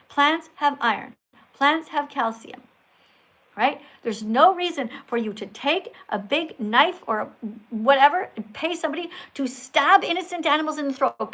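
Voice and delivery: normal talking voice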